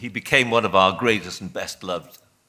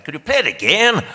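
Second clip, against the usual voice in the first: a rough attempt at a Northern Irish accent, pitched higher; a tentative stab at Northern Irish, raised and louder; 10 semitones higher, 7 dB louder than usual. John asks to hear the tape again, in an attempted Northern Irish accent. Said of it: "forgive me, I can't do the accent, but I'll try".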